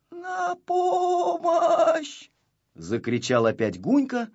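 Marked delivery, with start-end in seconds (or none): 0.1-2.3 very high, drawn-out, pitiful wail; long thin plaintive call; plaintive cry. A narrator's reading. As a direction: cry for help piteously, without shouting. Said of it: "не громко, а жалобно".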